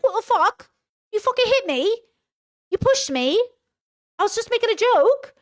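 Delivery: mock outraged yell. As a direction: squeal it out like an offended boyfriend. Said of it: "He's like".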